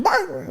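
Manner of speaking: a single high yelped bark; mock dog bark. Mimicking his dog's rare bark.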